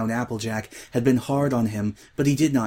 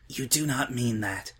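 soft, disbelieving; whisper; 7 dB quieter than usual. A narrator's reading. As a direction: barely dare to say it. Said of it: "Twilight whispered".

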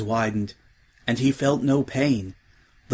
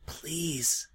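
very soft, defeated please; final quiet prayer; 4 semitones higher, 11 dB quieter than usual. A narrator's reading. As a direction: let the last hope die. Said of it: "he prayed one last time".

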